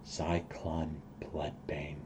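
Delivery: slow, hushed half-whisper; hushed dread. Acted. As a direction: breathe the name slowly and softly. Grim reaction to villain's name.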